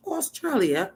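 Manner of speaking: calling out Australia, mate in a hammed-up Australian accent; mock Australian accent. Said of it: "I'm not good at accents".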